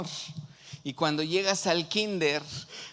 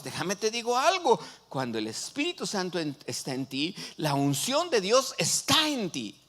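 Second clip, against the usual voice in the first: loud, higher-pitched preaching with punched key words; predicación enérgica; 7 semitones higher, 4 dB louder than usual. Real, not acted. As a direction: preach it with rising force to the congregation. Preaches at full force. (prédica ferviente, con voz alta y enfática)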